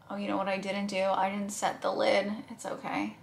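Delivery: ordinary talk, nothing marked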